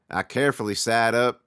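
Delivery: ordinary delivery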